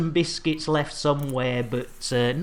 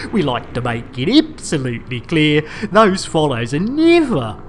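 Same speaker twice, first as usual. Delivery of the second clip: loud, grand public-service announcement voice; mock announcer; 6 dB louder than usual. Streamer addressing followers with a hammed-up announcement voice. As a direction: boom it out like a station tannoy.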